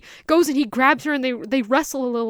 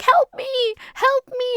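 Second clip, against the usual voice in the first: higher, pleading cry for help; desperate girl; 11 semitones higher, slower than usual. Retold or imitated. The girl hanging off the boat begging him, a high pleading cry. Girl dangling, voiced by narrator.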